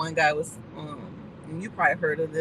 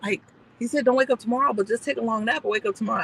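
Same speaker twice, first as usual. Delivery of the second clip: lightly voiced higher child protest; a hint of a kid correcting mom; imitated young son; 5 semitones higher, 5 dB louder than usual. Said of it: "my youngest son was like".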